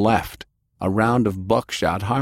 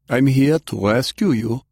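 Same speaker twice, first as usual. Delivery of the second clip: put-on higher-pitched German-accented voice; 5 semitones higher, 4 dB louder than usual. A narrator's reading. Stranger tells Delilah of his rescue, German accent. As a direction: state it with a firm German accent. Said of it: "he stated with a German accent".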